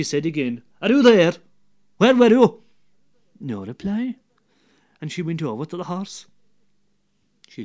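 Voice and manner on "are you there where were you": loud, higher call into the dark. Acted. Wife calling loudly for her husband in the dark yard. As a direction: call out for him, louder and higher.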